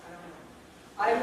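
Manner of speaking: softly voiced struggling child reader; stuck young reader. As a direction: lightly act a pupil giving up on a word. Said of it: "and they're going".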